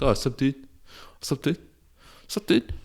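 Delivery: high put-on dude voice; imitated guy greeting. Guys greeting near-strangers, mimicked in high dude voice. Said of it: "something guys do where they'll be like".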